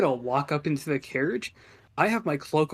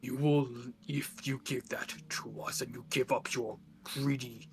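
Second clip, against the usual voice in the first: demonic voice; 7 dB quieter than usual. Acted. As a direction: whisper like a demon making demands. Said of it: "speak in my demonic voice".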